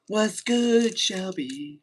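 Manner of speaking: high, loud put-on voice; squeaky greeting. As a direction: call out the greeting in a silly high voice.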